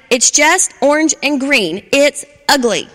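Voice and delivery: loud, mocking shout. Frappy shouting insults at Charlie, loud and scornful. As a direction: yell it with scorn. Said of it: "Frappy shouted".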